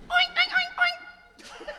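very high squeaky pig squeals; squeaky oinks. Retold or imitated. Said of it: "Old people make squeaky sounds".

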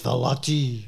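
Velati said French-style, "ee" at the end; French pronunciation. Shown as a demonstration. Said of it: "It sounds more French".

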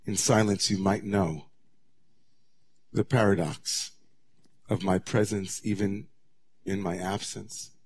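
soft, hushed, gentle voice of God. Retold or imitated. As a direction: ease down into a hushed, tender near-whisper. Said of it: "But God, in a softer tone".

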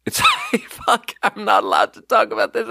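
breaks into high giggles while confessing; laughing, can't help spilling it. Real, not acted. Laughing confession about forbidden story.

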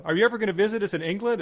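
fast, high, loud mimicry; acted eager question. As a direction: rush out a mock-eager farewell question.

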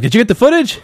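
high, loud acted eagerness; mock eager shout. Mimicking a man checking on shark attack survivors, mock eager. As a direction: blurt it high, mimicking an over-keen cameraman.

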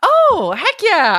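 high delighted "oh". Real, not acted. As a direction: burst out with joy. Delighted at the ending.

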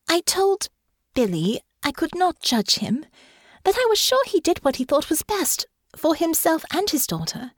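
high, earnest character voice; Honor's voice. Acted. Honor recalls what she told Billy, earnest. Honor's dialogue in narrated fiction.

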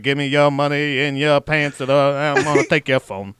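a hint of a mugging cab driver. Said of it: "said it like a cab driver".

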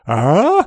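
loud, high questioning grunt; clueless "huh?" with a steep rise; baffled "uh?". Shown as a demonstration. Tourist spoken to in French, baffled grunt. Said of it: "and I do the".

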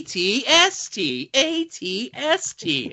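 "A T S T" drawn out slowly, rising high; sing-song AT-ST. Shown as a demonstration. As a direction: stretch out "A T S T" slowly, pitch climbing. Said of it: "you have to say it like that".

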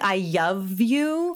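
slow "I love you" with a Y for the L; toddler mispronunciation. Retold or imitated. Mother demonstrates her daughter's words, toddler pronunciation. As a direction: imitate a toddler, 'love' said as 'yuv'. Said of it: "it sounded like".

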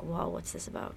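hushed, breathy whoa; whispered surprise. Retold or imitated. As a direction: whisper it like a startled reader. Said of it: "I was like".

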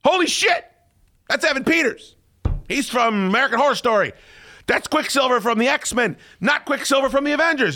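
high, excited rant at his wife; excited yelling. Retold or imitated. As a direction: explain it in a rush of excitement. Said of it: "I scream".

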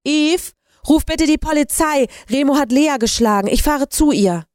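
high, urgent call to another room. A narrator's reading. Lydia to boyfriend in the living room, urgently calling out. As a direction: call out high, in a rush of alarm. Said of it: "Rasch rief Lydia ins Wohnzimmer".

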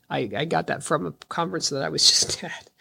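ordinary delivery

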